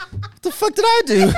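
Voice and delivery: high laughing outburst. Retold or imitated. Retelling her sister's online drama, laughing high. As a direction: squeal it through laughter.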